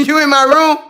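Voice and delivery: high, loud mock telling-off; playful scolding. Real, not acted. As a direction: holler at the pet caught out. Playful scolding of found dog.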